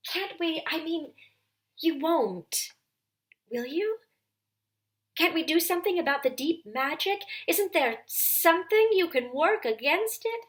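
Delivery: put-on pleading girl. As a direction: beg him, stumbling over words. Susan pleads desperately.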